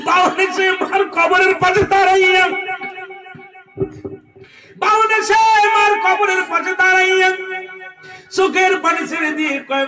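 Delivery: high, loud sermon narration; full-force preaching. A narrator's reading. Preacher tells of the son at his mother's grave, high loud preaching. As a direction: carry the story out loudly on a high note.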